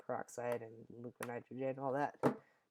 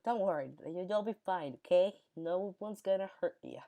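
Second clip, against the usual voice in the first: high, exaggerated comforting tone; mock reassuring; 6 semitones higher, 4 dB louder than usual. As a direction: coo at him like a hurt kid. Classmates comforting him.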